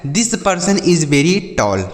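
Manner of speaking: each English word said slowly for the class; slow model sentence. Shown as a demonstration. Teacher to children, modelling an English sentence slowly. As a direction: spell it out slowly for learners. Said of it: "यह व्यक्ति बहुत लंबा है".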